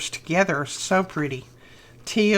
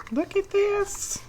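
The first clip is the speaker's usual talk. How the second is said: much higher, slow squeal of joy; delighted squeal; 13 semitones higher, 4 dB quieter, slower than usual. Real, not acted. Vlogger squeals over Susan's stamps, high and slow.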